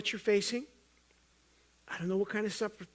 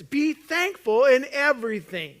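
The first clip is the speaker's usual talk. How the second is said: loud, slow and drawn out; slow, booming exhortation; emphatic preaching; 5 semitones higher, 11 dB louder than usual. Real, not acted. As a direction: proclaim it to the whole room. Preacher urging thanksgiving.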